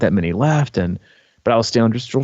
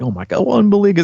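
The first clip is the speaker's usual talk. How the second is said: overwhelmed gasp; 11 semitones higher, 4 dB louder, much faster than usual. Retold or imitated. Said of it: "teary eyed".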